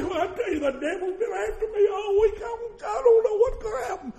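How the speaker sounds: high, fretful mocking voice of a worried believer; high whiny worrywart, lightly laughing; mock worrier.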